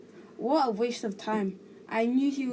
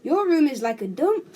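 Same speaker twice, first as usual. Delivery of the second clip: arguing back; 5 semitones higher, 7 dB louder than usual. A narrator's reading. Son argues back at mother.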